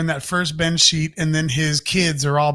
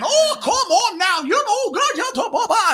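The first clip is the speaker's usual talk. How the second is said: hammed-up pushy talker; nonstop chatterer voice. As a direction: talk and never shut up. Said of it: "tell his voice in a way of how he made me feel".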